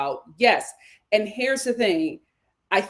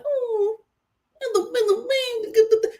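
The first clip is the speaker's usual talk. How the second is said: very high, cutesy mimicry; mock babying voice; 11 semitones higher, slower than usual. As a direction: imitate baby talk in a tiny high voice. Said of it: "It's just like".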